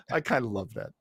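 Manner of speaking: ordinary talk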